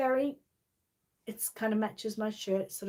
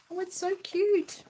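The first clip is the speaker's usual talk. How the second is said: delighted coo; 11 semitones higher than usual. Real, not acted. Admiring the book.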